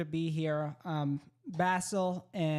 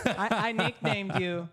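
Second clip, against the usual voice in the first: louder laughing confession; laughing admission; 4 semitones higher, 6 dB louder than usual. Real, not acted. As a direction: own up with a laugh. Guest laughing about the nickname.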